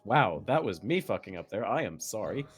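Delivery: usual speaking voice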